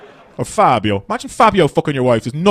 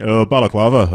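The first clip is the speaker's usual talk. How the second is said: gruff Belfast hardman, a bit louder; lightly done Belfast accent; 3 semitones lower, 4 dB louder than usual. Retold or imitated. Belfast man answering a chat line, light Belfast accent. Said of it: "guy from Belfast answers the phone".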